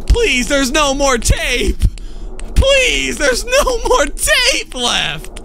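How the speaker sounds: high, slow pleading wail; whiny begging. Pretend. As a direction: beg desperately, voice climbing. Begging animatronic, no tape.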